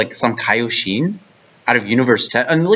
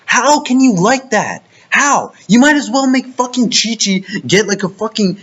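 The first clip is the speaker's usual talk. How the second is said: higher-pitched, incredulous rant; heated rant; 7 semitones higher than usual. Real, not acted. Fan ranting at anime lovers, incredulous and heated. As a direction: rant in disbelief, pitch rising.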